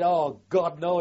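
loud, high cry of dismay; shocked outcry at the sight of his chained friend. Retold or imitated. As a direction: blurt it out in shock. Sees friend chained to police.

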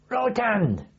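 high barked command; urgent call down to the driver; retold shouted order. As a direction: shout the turn to the driver over the engine. Directing blind tank driver.

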